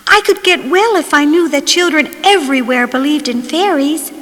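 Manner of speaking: ordinary delivery, nothing marked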